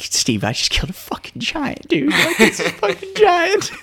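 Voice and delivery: rising, laughing excitement; excited. Retold or imitated. Hunter calling his friend about the kill, giddy laughing excitement. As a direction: blurt it out, laughing and thrilled. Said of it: "I'm like".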